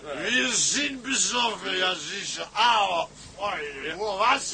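tipsy slurred captain; loose, swaying drunk talk. Acted. Drunk captain greeting his mates on board.

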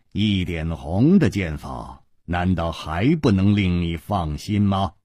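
low-pitched hero voice; composed, low and even; calm reassurance. A narrator's reading. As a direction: reassure coolly from low in the range.

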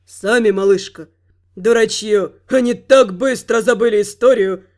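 low, scornful god voice; dark god voice. Acted. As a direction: gloat like an ancient god.

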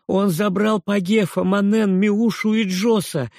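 high, loud pleading cry; boy's desperate shout. A narrator's reading. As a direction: shout it out, hoping for help. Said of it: "крикнул Робби". (крик мальчика, высоко и громко)

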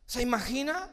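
normal talking voice

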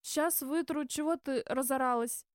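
put-on grumbling, defensive drunk; muttering drunk. A narrator's reading. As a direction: grumble back as the scolded drunk. Same drunk answers the scolding waitress. (пьяное недовольное бормотание)

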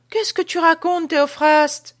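high, loud scolding wife; a wife snapping at her husband. Acted. Wife scolds her husband Théophraste, high and loud. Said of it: "dit-elle".

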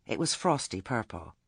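ordinary delivery, nothing marked

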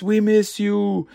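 a little brother begging, voice lifted high and slow; tearful plea. Acted. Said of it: "And Mikey's".

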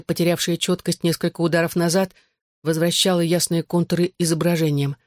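ordinary delivery, nothing marked

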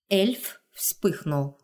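ordinary delivery, nothing marked

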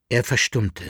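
plain reading voice

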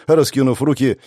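usual speaking voice

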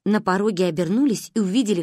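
usual speaking voice

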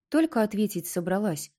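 ordinary delivery, nothing marked

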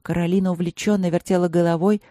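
ordinary delivery

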